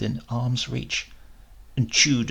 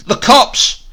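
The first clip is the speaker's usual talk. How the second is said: much higher, much louder alarm cry; shouted warning; 10 semitones higher, 12 dB louder than usual. Acted. Eura bursting in to warn the thieves, loud panicked shout. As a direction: yell it to warn the others in panic. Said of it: "but Eura shouted".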